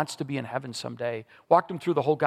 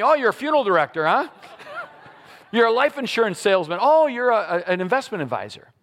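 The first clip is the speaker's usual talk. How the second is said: guessing guys; 7 semitones higher, 4 dB louder than usual. Retold or imitated. Guys guessing his job.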